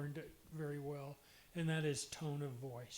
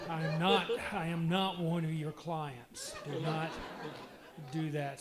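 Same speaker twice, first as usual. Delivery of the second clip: louder, slower mock-indignant rebuke; retold scolding; 7 dB louder than usual. Wife scolding husband for counselor voice, loud mock rebuke. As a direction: replay her telling him off, loud.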